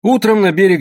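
ordinary delivery, nothing marked

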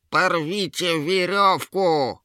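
high, drawn-out frantic shout; Yera yelling. A narrator's reading. Troll-woman orders trolls to break free, long high yell. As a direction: stretch the command into a desperate yell. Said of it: "завопила Ера".

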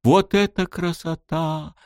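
put-on high, thrilled girl's voice; excited girl. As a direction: gush like an excited kid. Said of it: "возбуждённо листала".

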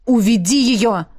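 curt, urgent command; abrupt order. A narrator's reading. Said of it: "отрывисто бросил тот". (отрывистый приказ)

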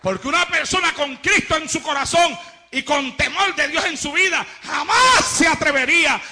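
loud, hammering sermon; preaching at full force. Acted. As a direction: proclaim it to the whole hall.

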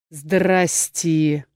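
low, monotone troll moan; lightly voiced troll. A narrator's reading. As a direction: drone the greeting like a troll. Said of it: "монотонно промычал". (монотонное мычание тролля)

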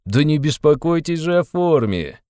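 high, slow teasing reassurance; playful, high-voiced needling; sly smirk. A narrator's reading. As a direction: interrupt with a drawn-out, mocking smirk. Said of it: "перебил Порфирий с прежней лукавой усмешкой".

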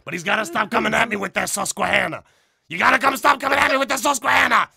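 shouted, blustering character rant; impression of loud ranter. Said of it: "That's how he sounds, dude".

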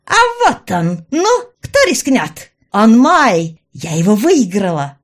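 high, loud gloating villainess; Раяна, gloating. A narrator's reading. Villainess shows off the stone she won, gloating. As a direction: crow in a high, forceful voice. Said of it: "не унималась Раяна".

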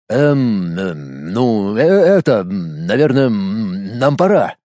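halting, slow, hesitant; flustered stammer. A narrator's reading. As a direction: stall awkwardly, then slow down. Boy flustered, evading question.

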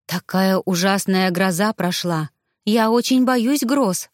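ordinary delivery

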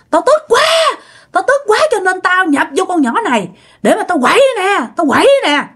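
high, wailing angry ghost; Trần Văn Nam. Retold or imitated. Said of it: "ổng đang hả là gào thét vậy đó". (giọng vong gào thét giận dữ)

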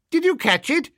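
high-pitched voice from the window; face at the window. A narrator's reading. Face asking if he caught the package, high voice.

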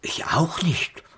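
whisper. Acted. Homais agrees in confidence.

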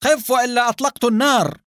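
high-pitched shouted threat; urgent high cry at a fleeing man; shouting. A narrator's reading. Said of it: "وهو يصيح".

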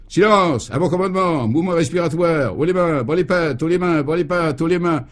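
high-pitched drill sergeant barking orders; drill commands. A narrator's reading. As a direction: rap out each order on the same high tune. Brigadier drills the captured crooks. (ordres criés d'adjudant)

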